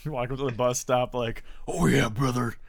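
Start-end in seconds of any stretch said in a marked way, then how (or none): 1.7-2.6 gravelly-free hyped wrestler promo, higher and louder; Randy Savage impression. Friend at a bus stop, as Macho Man hyping himself. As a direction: channel the Macho Man, puffed up. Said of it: "This year's Randy Savage".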